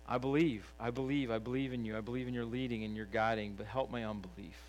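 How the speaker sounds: soft closing prayer. Acted. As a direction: pray it low and humble.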